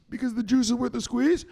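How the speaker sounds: high recited answer, rising at the end; dutiful follower. Acted. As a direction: play a pupil answering uncertainly. Follower's first take of the slogan.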